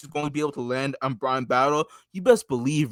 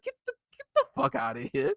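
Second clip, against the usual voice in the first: imitating angry Bruno; 6 dB quieter than usual.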